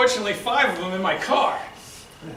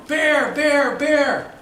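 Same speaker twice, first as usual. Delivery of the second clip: loud urgent calls; calling out warning; 5 semitones higher, 4 dB louder, much slower than usual. Retold or imitated. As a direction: shout the warning across. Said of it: "And I'm going".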